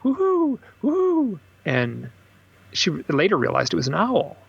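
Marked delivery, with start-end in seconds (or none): 0.0-1.4 high happy woohoo with a falling end; bright high hoots in the woods; joyous hoot call. Retold or imitated. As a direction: call out woohoo like a partying friend. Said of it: "this really happy, joyous".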